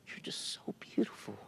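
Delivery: soft tender whisper; whispered compliment. Retold or imitated. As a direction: whisper it gently to her.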